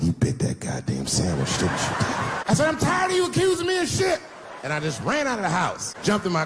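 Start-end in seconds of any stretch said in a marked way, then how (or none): 0.0-2.4 low, laughing accusation; wife accusing husband, low and mocking. Deep knowing taunt. 2.7-4.2 high yelled outburst; husband yelling back at accusing wife, high shout; defensive shout. Retold or imitated. Pitched-up indignant yell.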